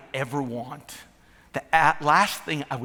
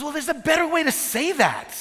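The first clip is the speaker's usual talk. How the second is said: much higher voice for the wife; mock wife; 11 semitones higher than usual. Husband retelling the wife, voiced much higher. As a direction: squeak it out as the wife. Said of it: "He goes".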